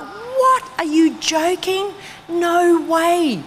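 high squeal of What, then no way; mock horror, pitched high; shocked disbelief. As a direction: act the shock of losing money. Investment dropped ten thousand.

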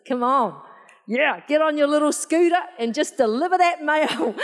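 rousing pep talk; hyped-up excitement. Real, not acted. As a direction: rev the congregation up, half laughing. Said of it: "I'm getting you all excited".

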